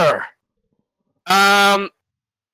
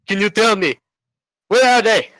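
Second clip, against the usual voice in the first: put-on a clumsy British accent, slightly higher; hammed-up James Bond British accent, higher on the end; bad British accent; 4 semitones higher than usual. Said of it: "that's a terrible British accent".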